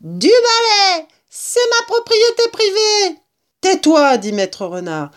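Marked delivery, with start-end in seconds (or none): 0.0-3.2 very high, loud squealing rat; yelling rat. A narrator's reading. Rat defending his private property, high yell. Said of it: "hurlait le rat". 3.6-4.2 higher, louder order; sharp command. A narrator's reading. Mister Fox silencing the rat, sharp loud order.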